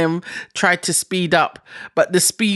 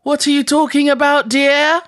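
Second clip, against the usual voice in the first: put-on forgetful old woman; 6 semitones higher than usual. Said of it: "let's do a quick role play".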